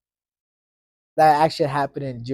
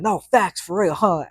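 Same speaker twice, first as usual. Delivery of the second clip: put-on higher and louder, a cocky kid taunting; mocking agreement; 6 semitones higher, 5 dB louder than usual. Kid taunting his crying teacher, mocking. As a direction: rub it in with fake agreement. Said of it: "And I was like".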